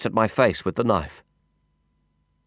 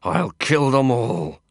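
growling through clenched teeth; 3 semitones higher, slower than usual. A narrator's reading. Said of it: "dragging the air in through clenched teeth and growling it out".

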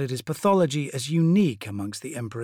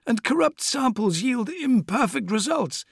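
Fabius voice; 11 semitones higher than usual. Acted.

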